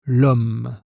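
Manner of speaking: "l'homme" said as one word, lom; elision demo. Shown as a demonstration. Teacher to learner, showing the contraction: one smooth word. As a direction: blend the article into "homme". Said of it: "on contracte les articles".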